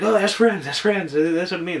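high, hurried-sounding mock panic; imitating a flustered guy correcting himself; flustered backpedal. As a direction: blurt it out high, covering a slip. Acting out his flustered backpedal.

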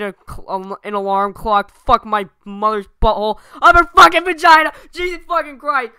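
high, loud screaming curses; over-the-top swearing rant. Pretend. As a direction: freak out and swear nonstop. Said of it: "swear a ton, like, way over the top".

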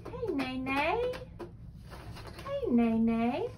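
drawn-out neigh, rising at the end; horse neigh. Acted. Parent reads blue horse page to child, playful neighing. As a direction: mimic a horse for a toddler, swooping up. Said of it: "He's gonna do the animal sound".

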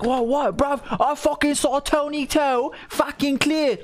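high, slow mock British outrage; put-on indignant British bruv, drawn out; angry British viewers. Viewers argue over his tier opinion, mock British outrage. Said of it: "people get angry at me".

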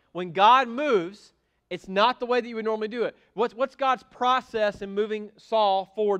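preaching. Acted. Pastor driving his point home to the church, loud then quick. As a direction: hammer the point home from the pulpit.

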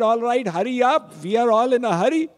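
rushed, fast and high; hurried. Acted. Ram urges Kewat to hurry, fast and impatient. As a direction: hurry him along impatiently.

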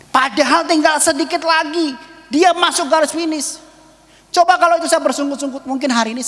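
high, loud sermon exhortation; impassioned preaching. Real, not acted. Preacher urging congregation near the finish line, loud and impassioned.

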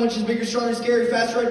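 ordinary talk

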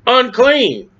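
high mock cry of unclean; mild mock leper yell; retold scream. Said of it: "who would scream".

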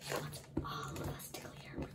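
unvoiced, soft whisper. Real, not acted. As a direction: lean in and whisper. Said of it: "let me whisper it to you".

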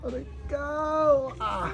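high, drawn-out cry of pain; slow wail as it hurts. Real, not acted. Patient under the healer's treatment, wailing in pain.

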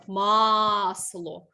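масло with the first А stretched long and loud; clear stressed vowel. Shown as a demonstration. Teacher shows pupils a clearly heard stressed vowel, drawing out the А in масло. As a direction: lean hard on the А in масло and hold it. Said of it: "чуємо чітко".

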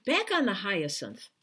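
plain reading voice

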